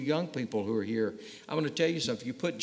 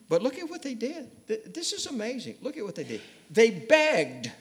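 much higher, excited preaching; fervent preaching; 9 semitones higher than usual. Acted. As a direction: lift the congregation with wonder. Preacher building to full force.

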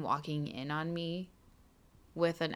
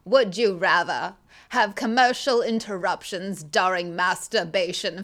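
put-on loud, quavering old Hollywood actress voice; Katharine Hepburn impression; 6 dB louder than usual. Asked by cohost, does a loud Katharine Hepburn voice. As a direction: play a grand old film star reading the question. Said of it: "Oh, Katharine Hepburn?".

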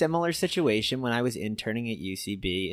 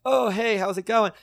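casual greeting; 10 semitones higher, 4 dB louder than usual. Retold or imitated. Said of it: "be like".

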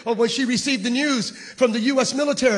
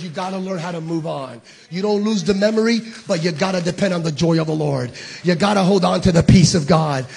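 tender quoted grandmother; 6 semitones lower than usual. Retold or imitated. Grandmother's advice.

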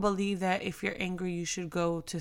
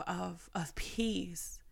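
whispered; 9 dB quieter, much slower than usual. Real, not acted. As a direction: breathe out peace in a whisper. Softening on the word peace.

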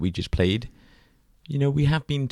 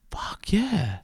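whispered; 12 semitones higher, 4 dB quieter than usual. Real, not acted. Excited agreement, whispered.

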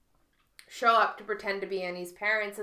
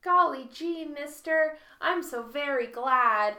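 put-on wholesome 1950s kid; 6 semitones higher, 5 dB louder than usual. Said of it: "it's very".

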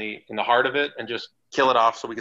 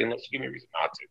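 a quick whispered aside; fast whisper; 9 dB quieter, much faster than usual. Real, not acted. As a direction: rush it out in a whisper. Aside about his attitude.

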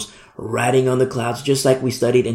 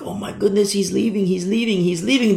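higher-pitched, repetitive voice of wonder; marveling; 6 semitones higher than usual. Retold or imitated. Disciples watch Jesus ascend, marveling. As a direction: act out mild astonished marveling.

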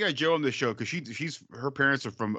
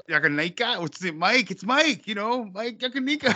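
high-pitched, eager movie-character voice; high impression of an over-friendly acquaintance; Mike Yanagita impression; 9 semitones higher than usual. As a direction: pitch it up high and push the name insistently. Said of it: "Steve Park as Mike Ya".

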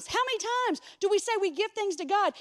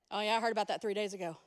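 offhand; 8 semitones lower, 5 dB quieter, much faster than usual. Retold or imitated. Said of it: "Jesus chill about Lazarus".

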